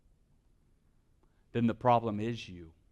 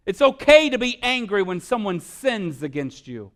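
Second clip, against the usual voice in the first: loud, high impassioned sermon; fiery preacher rising to a climax; preaching at full force; 7 semitones higher, 8 dB louder than usual. Acted. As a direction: build it like a pulpit call to righteous anger.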